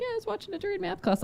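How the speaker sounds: high, soft daughter impression; teen daughter voice. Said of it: "how she was like".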